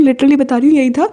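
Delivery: ordinary talk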